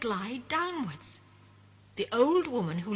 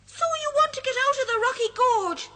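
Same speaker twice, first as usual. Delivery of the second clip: high, loud cheeky sprite voice; a mischievous sprite teasing the friends; 8 dB louder, faster than usual. Acted. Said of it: "he says".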